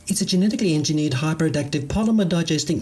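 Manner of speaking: nervous scientist correcting the builder. Acted. Mara the scientist objecting.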